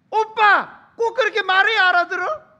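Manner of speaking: high, sustained angry yell; furious exasperation. Acted. Girlfriend fed up that he misses the meaning, furious yelling. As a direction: scream the reproach at the boyfriend. Acted lovers' quarrel.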